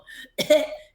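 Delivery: a high, loud burst of laughter. Real, not acted. Mother laughing while telling the podcast host, high burst. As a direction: laugh out at the memory. Laughing at her own story.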